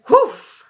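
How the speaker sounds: high, sudden "whoof"; sharp puff of blown air. A narrator's reading. Giant blows something at a sleeper's face, sharp puff. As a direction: blow out a loud quick puff.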